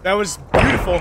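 shouting with excitement, pitch high; thrilled yell at a great hit; excited. Real, not acted. Thrilled by the mortar strike.

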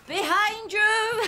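slow, drawn-out panto cry; panto call. Pretend. Pointing out Claude in dame dress, a slow hammed-up panto call.

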